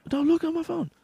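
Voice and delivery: very high mock Chinese accent; caricatured high-pitched Chinese accent. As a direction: squeal it in a put-on Chinese accent. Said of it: "we do such a racist Chinese guy".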